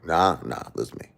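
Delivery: hushed wise-guy mumble, sinking to a whisper; Robert De Niro. Acted. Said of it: "Robert De Niro say".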